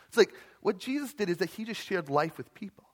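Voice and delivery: usual speaking voice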